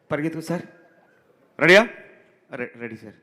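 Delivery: ordinary talk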